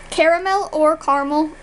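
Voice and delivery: CARAMEL in three syllables, then as "car-mel"; full CARAMEL, then shortened CARAMEL; two pronunciations. Shown as a demonstration. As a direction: give CARAMEL both ways, "care-a-mel" or "car-mel". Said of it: "How do you pronounce these words?".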